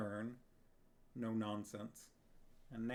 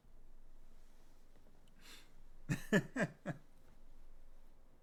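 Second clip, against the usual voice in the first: breathy laugh; 6 semitones higher than usual. Real, not acted. Laugh after singing.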